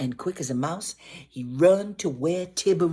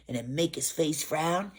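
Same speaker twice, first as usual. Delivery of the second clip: plain reading voice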